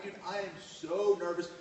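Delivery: ordinary delivery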